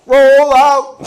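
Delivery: loud, high drawn-out call; mock battle cry. Comic relating to Erin in the wheelchair, mock rallying shout. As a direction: holler it like a rallying command.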